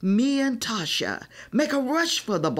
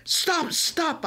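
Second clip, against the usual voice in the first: higher, mild urgent call; a light urgent yell; 10 semitones higher than usual. A narrator's reading. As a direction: try to break up the fight. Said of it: "I yell".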